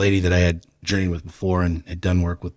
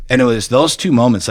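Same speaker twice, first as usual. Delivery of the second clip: normal talking voice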